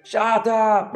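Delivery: put-on high drunken snap; drunk king. Drunken king snapping at the clock, high. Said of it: "He's like".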